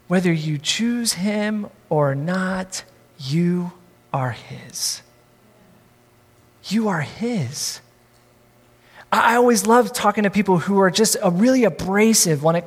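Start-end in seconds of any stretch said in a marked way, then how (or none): 0.1-7.8 slow, much softer, intimate; hushed tender. Real, not acted. Preacher assuring listeners they belong to God, hushed. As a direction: drop right down and speak it gently.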